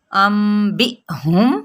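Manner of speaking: slow tajweed recitation of ام بی ہم with lip ikhfa; Quranic recitation. Shown as a demonstration. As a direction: recite ام بی ہم slowly, nasal hum on the meem. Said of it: "اخفاء شفوی کا قاعدہ ہوگا". (تجوید کے ساتھ آہستہ تلاوت)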